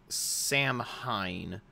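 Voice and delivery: Samhain sounded out slowly as SAM-HINE; spelling pronunciation. Shown as a demonstration. Host reading the festival word as it looks, slow SAM-HINE for Samhain. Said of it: "it looks like".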